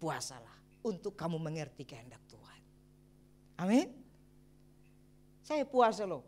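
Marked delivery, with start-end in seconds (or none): 0.0-2.6 softer whispered repeat of the point. Shown as a demonstration. Pastor repeating the final point to the church, whispered. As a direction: breathe it out in a whisper. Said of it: "Dan yang keempat".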